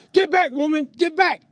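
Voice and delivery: loud, high command; angry father. Retold or imitated. Father to mother intervening, angry order. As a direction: imitate a dad yelling her away.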